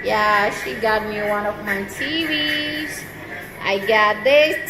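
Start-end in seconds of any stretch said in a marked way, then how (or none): none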